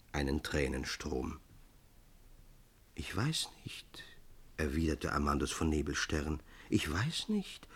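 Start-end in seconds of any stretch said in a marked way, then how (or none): 2.9-4.0 hushed, breathless whisper. A narrator's reading. Amandus whispering to weeping Ännchen, hushed. Lover whispers his reply. 6.7-7.7 gently voiced, a bit slow, rising; soft puzzled. A narrator's reading. Amandus gently puzzled at Ännchen's tears, soft. Same words, softly voiced.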